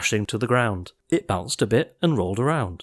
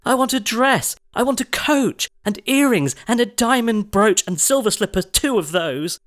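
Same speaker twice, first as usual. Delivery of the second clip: demanding shout; 10 semitones higher than usual. A narrator's reading. Cindy shouting her demands at the fairy, high and bratty. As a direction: demand it like a brat. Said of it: "and shouted".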